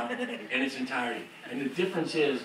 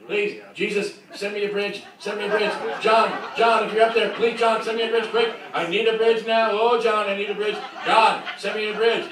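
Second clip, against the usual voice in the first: a touch higher, growing louder and more frantic; mock desperate prayer; 6 semitones higher, 5 dB louder than usual. Songwriter silently praying for a bridge, mock desperate. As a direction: beg heaven in a comic panic.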